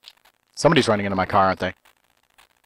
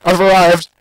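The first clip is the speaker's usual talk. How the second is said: triumphant announce; 8 semitones higher, 6 dB louder than usual. Pretend.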